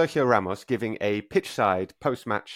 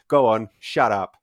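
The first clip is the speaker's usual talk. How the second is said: louder, slowed-down imitation of an angry "shut up"; hammed-up angry dismissal, louder and slow; mock furious retort; 8 dB louder, slower than usual. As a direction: imitate a player snapping at a heckler. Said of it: "like this".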